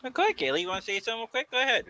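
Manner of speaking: high, soft coaxing voice. Real, not acted. Coaxing a child to speak.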